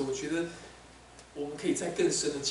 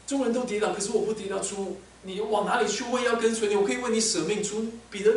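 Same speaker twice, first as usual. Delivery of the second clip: louder and higher, recalling his boast; echoing bold vow; 5 semitones higher, 4 dB louder than usual. Acted. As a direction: ring out the old promise. Jesus recalling Peter's boast. (耶稣高声重提彼得当初的誓言)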